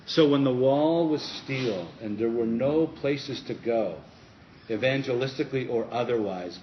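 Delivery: preaching. Acted. Preaching emphasis.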